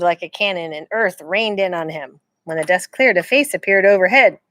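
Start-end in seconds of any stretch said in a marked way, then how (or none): none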